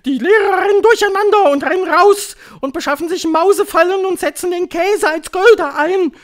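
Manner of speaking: high, loud ranting witch; piercing, wild proclamation; frenzied Grand High Witch. A narrator's reading. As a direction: shout it gleefully as a witch leader, building to a yell.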